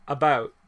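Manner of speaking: "about" with a relaxed ow diphthong; real Canadian "about". Shown as a demonstration. Host showing what we say, natural Canadian "about". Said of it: "We say, like".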